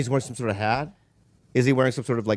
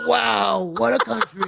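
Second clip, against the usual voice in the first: Trump impression; 8 semitones higher, slower than usual.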